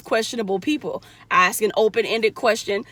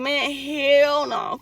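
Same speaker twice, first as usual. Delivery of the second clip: slow, drawn-out imitated refusal; slow mock refusal of an unvaccinated person; imitating refusal; 4 semitones higher, much slower than usual. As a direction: drawl the refusal out. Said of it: "they'll be like".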